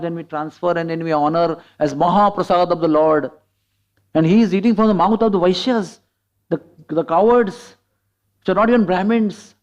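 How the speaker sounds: loud, emphatic acted protest; indignant disbelief. Gandharvas complaining to Brahma about the Lord, indignantly incredulous.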